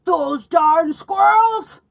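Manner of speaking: slow, loud, high shout with the words stretched out; angry old man yell. Acted. Old man yelling at squirrels who stole the bird food, loud and slow. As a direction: shake your fist and bellow it. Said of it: "he shook his old man fist and yelled".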